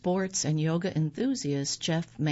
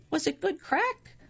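high Irish lilt; 11 semitones higher than usual. Retold or imitated. Mock Irish pub chat.

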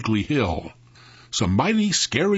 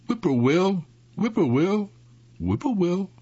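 high, lilting imitation of a whippoorwill; sing-song whippoorwill call; 7 semitones higher than usual. A narrator's reading. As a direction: call it out like a night bird, pitched up. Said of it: "whistling their favorite sing-song tune".

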